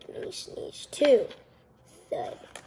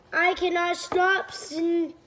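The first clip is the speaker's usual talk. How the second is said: usual speaking voice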